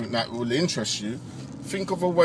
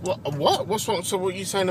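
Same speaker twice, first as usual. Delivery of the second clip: mock surprise; 7 semitones higher than usual. Mock shock at chicken remark.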